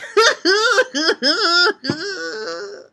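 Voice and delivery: high giggle. Real, not acted. Laughing at joke.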